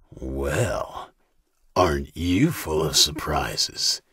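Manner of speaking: deep slow smug captor, surprised. A narrator's reading. Captor mocking Katie, low and slow. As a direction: drawl it low and slow like a pleased brute. Dawson voiced by narrator.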